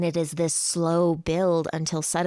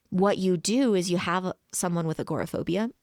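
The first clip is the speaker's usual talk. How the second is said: ordinary talk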